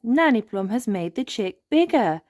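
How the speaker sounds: usual speaking voice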